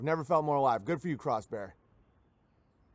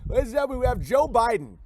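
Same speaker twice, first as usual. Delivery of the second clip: higher, louder showman call; mock announcer; 8 semitones higher, 5 dB louder, faster than usual. Streamer mock-introducing Joe Biden like a game-show host, loud.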